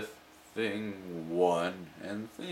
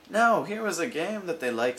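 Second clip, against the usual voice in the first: showman cat; 7 semitones higher than usual. Acted.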